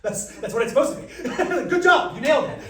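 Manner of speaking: loud, high, laughing; laughing praise. Real, not acted. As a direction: praise it while cracking up.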